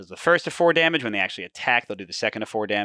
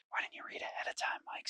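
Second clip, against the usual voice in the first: very soft, voiceless aside; whispered self-reproach; 18 dB quieter than usual. Real, not acted. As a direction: scold yourself under your breath. Scolds himself under his breath.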